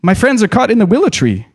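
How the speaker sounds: breathless cry. Acted. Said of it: "cried Frodo breathlessly".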